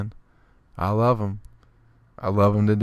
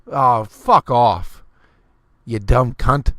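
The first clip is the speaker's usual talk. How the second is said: slow, louder fuck-off shot back; angry retort; 5 dB louder, much slower than usual. Acted. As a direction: bite back hard at her. Snapping back at the girl.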